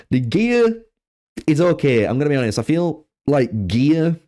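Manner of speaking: usual speaking voice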